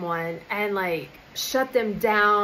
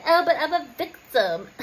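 higher, louder, fake-crying whine; mock whiny victim; 6 semitones higher, 4 dB louder than usual. Mocking Creator who plays the victim, in a higher, louder fake-cry whine. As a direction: ham up a self-pitying victim, half crying. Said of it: "But if Creator's like".

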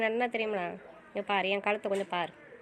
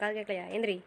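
ordinary delivery

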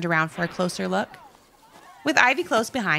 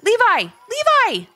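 high, loud excited shout; excited calling; 5 dB louder, slower than usual. A narrator's reading. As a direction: yell his name from the top. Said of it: "exclaimed Ivy from the top".

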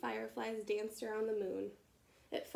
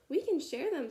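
higher, louder, friendly offer; friendly ladybug; 6 semitones higher, 7 dB louder than usual. A narrator's reading. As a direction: sweetly offer to share. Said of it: "suggested the friendly ladybug".

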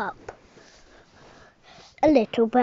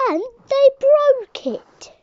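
much higher, loud, slow wail; wailing baby bear; 13 semitones higher, 9 dB louder, slower than usual. Acted. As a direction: wail it out heartbroken.

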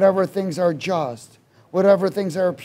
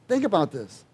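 ordinary talk, nothing marked

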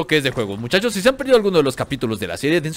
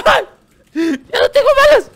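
much higher, louder wail through laughter; laughing panic; 8 dB louder than usual. Real, not acted. Gamer out of bullets, laughing panicked wail. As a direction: cry it out half laughing in despair.

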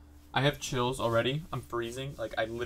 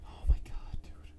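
frightened whisper. Real, not acted. Viewer tense at a scary video, frightened whisper. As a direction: whisper it under real dread.

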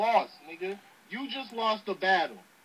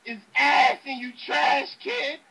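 loud, raised impression of a battle rapper; Hollow; 4 semitones higher, 5 dB louder than usual. Rapper hands his verse to a friend's voice to diss an opponent, loud impersonation. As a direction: punch out the bars higher and louder in another rapper's voice.

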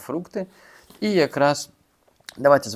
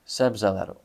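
Себзелер with the stress on the final syllable -лер; stress on the last syllable. Shown as a demonstration. Teacher demonstrating stress on the final syllable of Себзелер. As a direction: land hard on -лер at the end. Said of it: "Наголос на останнє".

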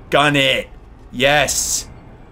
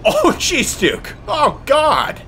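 shocked cry; 13 semitones higher, much slower than usual. Real, not acted. Reacts to shocking moment.

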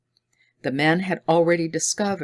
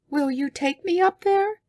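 put-on high, wondering girl. A narrator's reading. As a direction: ask like a curious girl.

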